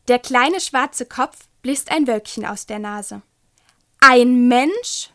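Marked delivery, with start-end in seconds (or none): 4.0-5.1 loud astonished hiss; little dragon hisses in disbelief at Hanno. Acted. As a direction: spit the question out like a startled dragon. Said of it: "faucht er".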